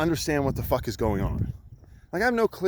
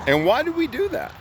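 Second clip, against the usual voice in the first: much higher rhetorical ask; high rhetorical question; 13 semitones higher than usual. Real, not acted. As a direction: toss the question up high to the room.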